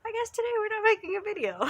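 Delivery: high, loud, put-on defeated voice; hammed-up whiny give-up; mock resignation. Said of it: "I'm like".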